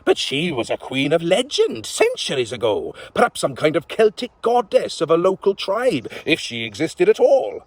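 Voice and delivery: put-on old witch recalling a legend. Witch continues musing.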